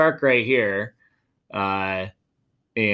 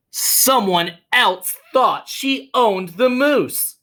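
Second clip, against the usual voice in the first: higher, slow, suspenseful; dramatic reveal; 11 semitones higher, 6 dB louder, much slower than usual. A narrator's reading. Teacher revealing the twist, high and slow. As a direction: lean in and stretch the twist.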